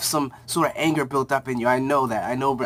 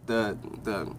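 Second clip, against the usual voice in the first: ordinary delivery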